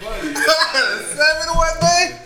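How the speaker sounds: high, loud and slow through laughter; laughing hard as he says it. Real, not acted. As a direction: crack up while repeating it. Laughing at own confession.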